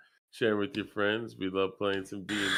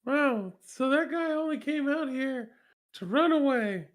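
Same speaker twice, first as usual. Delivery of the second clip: very high falsetto character voice; squeaky game character. Acted. Player in character griping about the guy who ran away, high falsetto. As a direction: pipe it up high, fed up.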